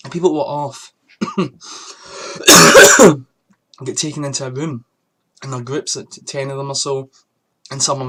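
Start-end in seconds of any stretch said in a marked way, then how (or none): 1.2-3.2 long, loud coughing fit; a real cough breaking the rant. Real, not acted. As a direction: cough it out hard. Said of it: "I get a terrible cough".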